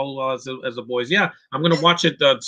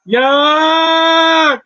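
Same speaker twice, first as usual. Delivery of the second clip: mock high yelp; 14 semitones higher, 9 dB louder than usual. Reacting to battle on screen.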